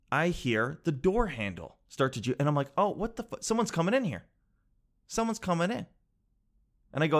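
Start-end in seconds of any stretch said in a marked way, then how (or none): 2.8-5.9 higher-pitched mild panic; retold alarm. Napper hears someone at the door, retold alarm.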